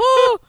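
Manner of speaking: high, loud mock shriek; shrieked "oh" of a shocked crowd; imitated crowd scream. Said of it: "like, screaming".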